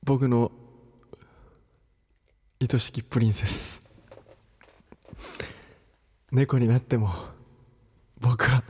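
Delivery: low, slow, tender prince. Acted. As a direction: woo her like a fairy-tale prince. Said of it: "本気の王子様".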